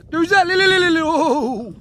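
loud high trilling call to the sheep; herding call. Shown as a demonstration. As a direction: sing out a shepherd's trill. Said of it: "Böyle işte bağırıyorsun".

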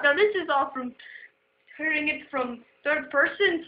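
ordinary talk, nothing marked

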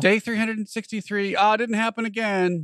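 high, drawn-out daily update; mock video diarist. Co-host mocks her TikTok accent diary, hammed-up disappointment. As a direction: put on a high, slow vlogger voice.

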